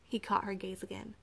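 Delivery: ordinary delivery, nothing marked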